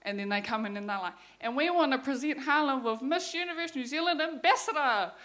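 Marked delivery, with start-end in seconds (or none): 1.4-5.1 higher-pitched, sing-out presenter impression; mock pageant announcer. TV host presenting Harlem on stage, mock announcer. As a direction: imitate a live TV host presenting a title. Imitates presenters on live television.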